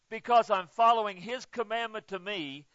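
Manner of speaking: ordinary delivery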